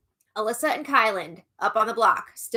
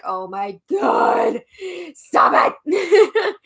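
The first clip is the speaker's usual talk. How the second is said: exasperated outburst; 6 semitones higher, 6 dB louder, much slower than usual. Retold or imitated. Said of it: "I'm just like".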